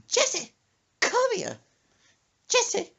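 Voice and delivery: high, loud, sharp calls; mama calling out. Retold or imitated. Mother summoning son, high mock-threatening call. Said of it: "Then she goes".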